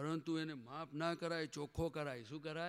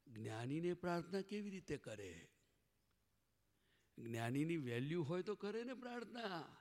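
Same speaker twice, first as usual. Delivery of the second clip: ordinary talk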